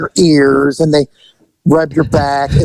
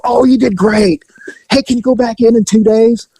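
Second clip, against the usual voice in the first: upbeat handler; 7 semitones higher than usual. Retold or imitated. Handlers voiced in story.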